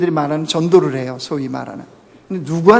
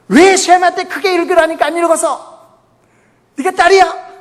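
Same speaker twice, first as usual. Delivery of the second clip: much higher and louder mock scolding parent; parent scolding child; 10 semitones higher, 4 dB louder than usual. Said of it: "부모가 아이 야단치듯이".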